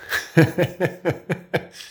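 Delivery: a quick breathy burst of laughter; short laugh. Real, not acted. Guest laughing at the host's question, short breathy laugh. Laughs at being asked who he is.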